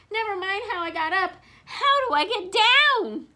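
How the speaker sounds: high, loud, wailing plea; panicky demand. A narrator's reading. Stranded Wilbur pleads from the tree, loud high wail. Wilbur stuck, wants to get down.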